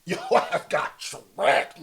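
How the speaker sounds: slow taunt breaking into laughter; laughing taunt. Real, not acted. Streamer mocks tricked viewers, laughing breathless taunt. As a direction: laugh through the repeat of your ass got tricked.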